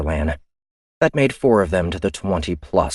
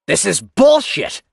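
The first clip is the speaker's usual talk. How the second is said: slow, louder, higher angry shout; 13 semitones higher, 6 dB louder, slower than usual. Acted. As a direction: yell it from across the room. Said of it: "a voice shouted".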